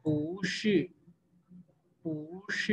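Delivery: पूश said soft with a rising pitch; quiet rising पूश, bu as second tone; bu tone change demo. Shown as a demonstration. Teacher models बू शी sandhi, soft. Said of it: "पू को फिर हम".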